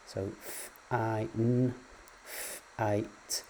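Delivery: f, I, n then f, I, t sounded one by one; sounding out phonemes. Shown as a demonstration. Teacher segmenting fine and fight into phonemes. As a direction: segment each word sound by sound. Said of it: "the difference between the phonemes n and t".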